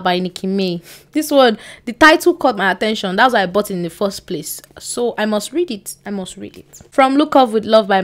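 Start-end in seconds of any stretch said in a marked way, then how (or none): 6.0-6.8 much softer, almost under her breath; quiet to herself. Real, not acted. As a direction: repeat it softly to yourself. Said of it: "repeats the line".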